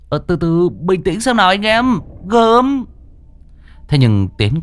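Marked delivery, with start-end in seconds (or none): 0.1-2.8 high-pitched, raised call to settle down; urgent calming plea. A narrator's reading. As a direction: break up a fight, voice pushed high. Bystander calls for calm in brawl. (cao giọng can ngăn)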